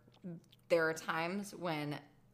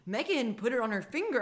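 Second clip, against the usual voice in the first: ordinary delivery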